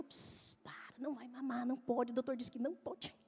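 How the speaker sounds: soft shushing whisper; hushing whisper. Retold or imitated. Mother hushes her screaming toddler at night, soft whisper. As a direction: hush her so the neighbours don't wake.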